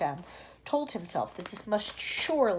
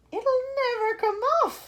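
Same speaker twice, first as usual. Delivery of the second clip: high, bright gloomy cheer; brightly; 13 semitones higher, 6 dB louder than usual. Acted. Earthworm announcing doom brightly, high and loud. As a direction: sound cheerfully doomed. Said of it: "the earthworm said brightly".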